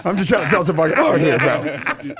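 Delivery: laughing through a blunt "bro" rant; a mock exasperated seller with the accent gone; retelling the seller dropping his French accent, laughing. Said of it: "lost his French accent".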